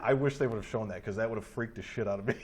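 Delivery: normal talking voice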